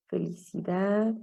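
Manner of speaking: ordinary talk, nothing marked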